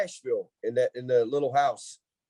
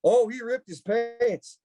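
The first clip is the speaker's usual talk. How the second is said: high, drawn-out announcement; hammed-up high aunt voice; imitating his aunt; 9 semitones higher, slower than usual. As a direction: stretch it out like a tattling aunt. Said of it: "She goes".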